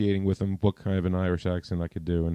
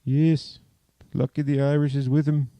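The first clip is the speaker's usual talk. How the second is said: higher, louder mock Irish leprechaun voice; over-the-top Irish accent, cheering for the win; 7 semitones higher, 4 dB louder than usual. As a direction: keep the Irish leprechaun lilt going to the end. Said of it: "make him sound like a leprechaun".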